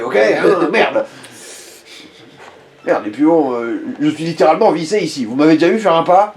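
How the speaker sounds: lightly voiced grumbling innkeeper; a hint of a fed-up barman; gruff innkeeper. Innkeeper talks to players.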